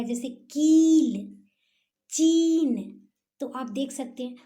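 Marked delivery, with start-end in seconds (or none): 0.3-3.1 कील, चीन with the ई held long and loud; long ई sound. Shown as a demonstration. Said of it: "देर तक ई का उच्चारण होगा".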